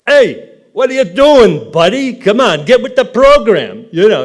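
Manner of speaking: loud, high hollering at a buddy; hammed-up shout; mock scolding yell. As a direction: holler at him to get with the program. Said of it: "and it's like".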